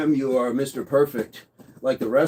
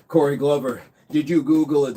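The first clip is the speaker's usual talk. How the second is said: ordinary talk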